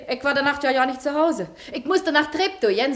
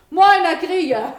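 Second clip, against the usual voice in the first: loud greeting; 4 semitones higher, 6 dB louder, slower than usual. Acted. Washerwoman greets the accuser loudly.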